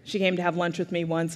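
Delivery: ordinary talk, nothing marked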